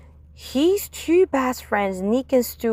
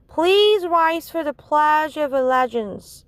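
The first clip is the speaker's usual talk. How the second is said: put-on higher, formal kid announcement; child announcing; 7 semitones higher than usual. A child calling the school to the pledge, higher.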